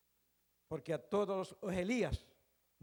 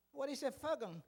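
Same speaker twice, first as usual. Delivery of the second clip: mock English accent; 6 semitones higher than usual. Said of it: "es fogón y lleva acento".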